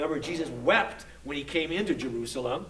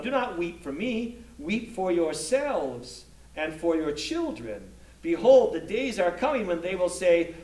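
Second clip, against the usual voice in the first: raised, fuller voice reading the gospel passage aloud; scripture reading; 2 semitones higher, 3 dB louder than usual. Acted. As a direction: proclaim it to the class like a lector.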